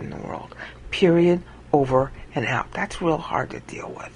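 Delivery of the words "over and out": usual speaking voice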